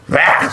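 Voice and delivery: short high yelled roar; mock roar. Pastor imitating the climax of a rebuke, high yelled roar. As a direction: burst into a sudden shout. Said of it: "소리 지릅니다".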